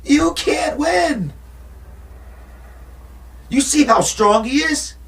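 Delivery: high, slow, shouted plea; imitating Adrian begging Rocky to see the danger; scared Adrian. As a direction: cry it out high and slow, pleading. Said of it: "She was like".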